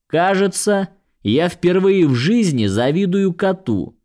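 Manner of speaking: high wistful fairy voice; pixie. Acted. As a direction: sigh it as a sulky fairy. Fey man quoted, envying cat.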